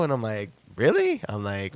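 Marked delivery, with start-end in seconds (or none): 0.8-1.2 big high leap of disbelief; can't believe the offer; stunned delight at a show offer; surprised. Retold or imitated. Owner offers him a show.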